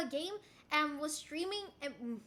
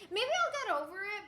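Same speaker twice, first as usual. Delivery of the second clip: usual speaking voice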